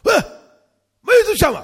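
sudden high, loud challenge; barked high alarm at an intruder; guard's shout. Acted. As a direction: shout out like a startled sentry. Guards shout on spotting him.

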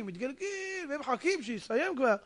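ordinary talk, nothing marked

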